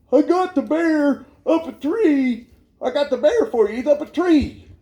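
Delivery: imitated drunk. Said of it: "Oh, he's drunk".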